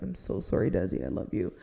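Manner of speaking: soft, low, tender words to a beloved car; tender apology. Real, not acted. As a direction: murmur it like comforting a pet.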